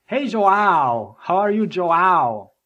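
Joao as "jo-OW", the OW drawn out; German mispronunciation. Retold or imitated. Germans greeting him by a mangled name, stretched "Joao". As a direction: lean long and hard on the OW in Joao. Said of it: "everybody called me Joao".

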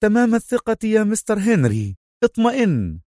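high, confident reassurance; lightly amused, high-pitched certainty; amused confidence. A narrator's reading. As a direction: reassure him breezily, pitch lifted. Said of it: "ضحك كال وهو يقول".

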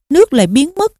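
ordinary delivery

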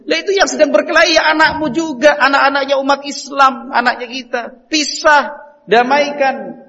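loud, high, urgent preaching; forceful preaching. Acted. Preacher urging the congregation, loud and insistent. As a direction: drive it home to the congregation.

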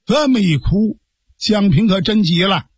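usual speaking voice

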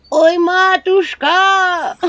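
high, very slow, loud call with a cackle; an old crone hailing the witch; a drawn-out cry then a laugh; old woman calling. Acted.

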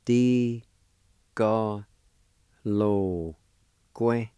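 Di, ga, lo, que said slowly as separate syllables. Shown as a demonstration. Teacher sounding out Di, ga, lo, que for learners. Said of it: "it's pronounced like this".

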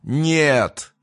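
громкий высокий крик злодея; выкрикни это протяжно, на высокой ноте; отчаянный рёв. A narrator's reading. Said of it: "взревел Крион". (отчаянный громкий рёв)

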